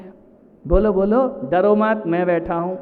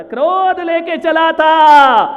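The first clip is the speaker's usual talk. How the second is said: high, loud, long-drawn shout; thundering repeat; 7 semitones higher, 9 dB louder, slower than usual. Real, not acted. Preacher on anger carried from home, shouted slowly. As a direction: stretch "क्रोध" and throw it out loudly.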